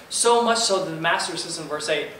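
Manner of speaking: usual speaking voice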